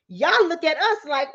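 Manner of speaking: ordinary delivery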